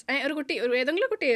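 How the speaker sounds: ordinary delivery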